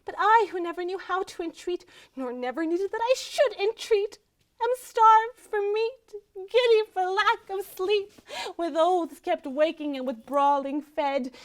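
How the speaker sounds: indignant complaint. Acted. Starved wife railing at her husband's spite, indignant.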